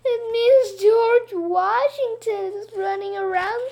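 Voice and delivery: high, slow hammed-up sob; mock weepy wail. A narrator's reading. Teacher sobbing the punchline to the kids, slow and high. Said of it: "Missus Rupee cried".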